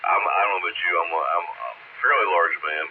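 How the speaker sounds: normal talking voice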